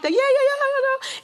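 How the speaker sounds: very high, rapturous string of cheers; excited and happy. Retold or imitated. Celebrating backstage before meeting Clinton, happy squealing. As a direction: re-enact squealing with glee. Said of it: "We all excited, and everybody happy".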